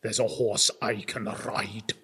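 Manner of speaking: low, slow, grave deathly voice; slow it down into a deep grim reaper; Christopher Lee as Death. Retold or imitated. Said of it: "trying to do an impression of him".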